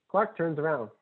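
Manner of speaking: ordinary talk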